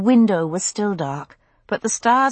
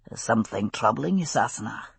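low, drowsy Scottish murmur; half-asleep husband, lower and softer; sleepy Scottish man; 7 semitones lower, 5 dB quieter than usual. A narrator's reading. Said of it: "said a sleepy voice".

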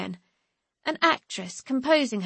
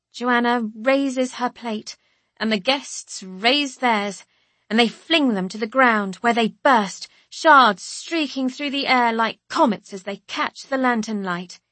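ordinary delivery

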